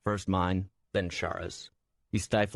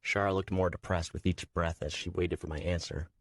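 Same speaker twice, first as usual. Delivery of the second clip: ordinary delivery, nothing marked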